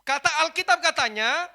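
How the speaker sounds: very loud, high, impassioned sermon; full-force preaching. Acted. Preacher argues over blessing unmarried parents, shouting at full force. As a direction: shout it out to the congregation, pitched high.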